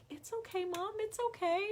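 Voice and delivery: high, soft imitated child; comforting little son. Mom imitates son wiping her tears, high soft comforting. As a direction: soothe her in a small high voice.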